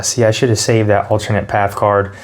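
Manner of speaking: normal talking voice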